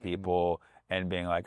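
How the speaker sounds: ordinary talk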